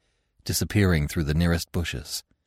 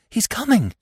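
far higher, frightened urgent cry; frantic; 3 dB louder than usual. A narrator's reading. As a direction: warn in panic. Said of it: "she whispered frantically".

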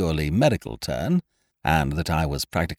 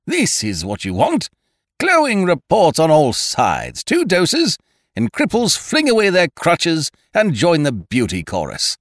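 loud, higher, emphatic patter; booming sales pitch; 10 semitones higher, 5 dB louder than usual. A narrator's reading. Ukridge selling his tonic to the hostess, booming. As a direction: boom it like a salesman. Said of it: "he boomed".

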